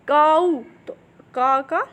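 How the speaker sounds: slow, loud, drawn out; slow demonstration. Shown as a demonstration. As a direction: stretch काऊ slowly so it can be heard clearly. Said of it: "ध्यान से सुनिएगा".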